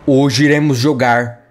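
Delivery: dramatic announcer. Acted. Gamer hypes the new dinosaur to viewers as announcer. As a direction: announce it like a big reveal.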